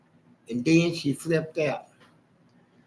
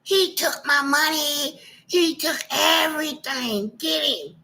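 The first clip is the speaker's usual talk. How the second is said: mock woman screaming; 10 semitones higher, much slower than usual. Imitating his wife screaming to the crowd, high mock shriek. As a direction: ham up a woman yelling for a crowd. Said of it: "I kept hearing her scream".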